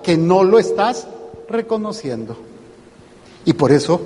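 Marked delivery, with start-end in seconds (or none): none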